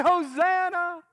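high loud slow Hosanna; preacher calling out Hosanna for the crowd; loud cry. Shown as a demonstration.

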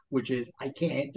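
normal talking voice

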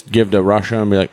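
ordinary delivery

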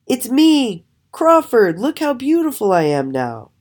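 high, loud self-announcement; excited showing off. A narrator's reading. Transformed raven revealing himself to other ravens, excitedly boasting. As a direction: announce yourself high and proudly.

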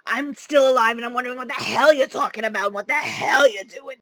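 shrieking Jersey mom calling her son; Howard Wolowitz's mother. Shown as a demonstration. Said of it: "This is the voice I'm hoping I'll be able to do".

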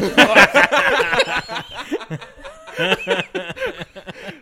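high, loud burst of laughter; cracking up hard. Real, not acted. Laughter after impression.

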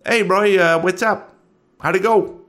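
high, loud buddy voice; Bugsy. Acted. Driver greets Roy outside the prison, high hearty voice. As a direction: pitch it up, calling out to a pal. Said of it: "to where Bugsy is idling in the U-Haul".

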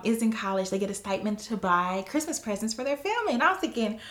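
usual speaking voice

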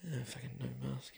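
whispered, voiceless mutter under his breath. Retold or imitated. Chicken shop worker mutters at maskless customer, whispering. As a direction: grumble it almost silently to yourself. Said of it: "he kind of mutters".

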